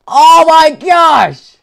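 high, loud and slow; shocked cry. Real, not acted. Shock at game event.

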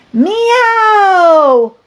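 high, loud, long meow; loud cat meow. Acted. The cats answer the narrator, loud high meow. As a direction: belt out a big cat meow.

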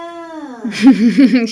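amused laugh over the snack; real laugh. Real, not acted. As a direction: let a laugh burst out. Mother laughs at baby.

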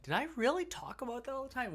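high-pitched mock disbelief; Damien's incredulity. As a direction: mimic a friend's squeaky, incredulous question. Imitates friend's incredulous question.

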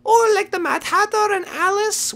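very high little creature voice; rabbit guide. Pretend. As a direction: give the warning in a squeaky rabbit voice.

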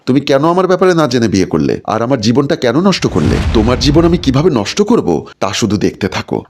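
plain reading voice

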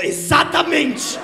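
high, loud, drawn-out outburst; hammed-up cry, stretching the word; emphatic shout. Stoned partner seizes on comedian's answer, acted drawn-out shout.

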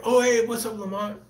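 high imitated fan greeting; imagined fan. A passerby in LA greets him, high mock cheery fan. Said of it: "someone saying".

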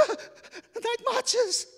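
high, loud, breathless terror; acted shriek of alarm; panicked gasp. Panicked boy spots the night marchers.

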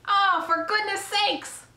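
very high, drawn-out outcry; exasperated yell. A narrator's reading. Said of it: "yelled Mister Zuckerman".

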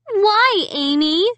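high, hurt questioning; trembling voice. A narrator's reading. Sister asking Amy why, high trembling voice. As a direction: plead for a reason, high and upset. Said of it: "asked me in a trembling voice".

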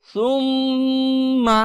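সুম্মা with the ম্ম hummed long; slow Quranic recitation; held nasal ghunnah. Shown as a demonstration. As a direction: stretch the nasal hum in সুম্মা. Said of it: "গুনগুন আওয়াজ".

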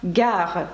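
"gare" with hard g and French r; French pronunciation. Shown as a demonstration. Teacher giving learners the word for station, French pronunciation. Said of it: "таке слово, як «gare»".

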